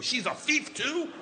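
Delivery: a father reeling at the news; incredulous. Retold or imitated. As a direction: drag it out in outraged shock. Dad hears it was his weed.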